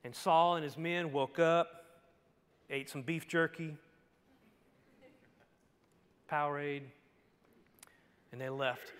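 ordinary talk, nothing marked

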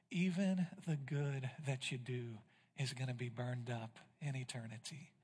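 quiet, intimate sermon voice; hushed preaching. Acted. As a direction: lean in and speak it quietly to the congregation. Soft intimate preaching stretch.

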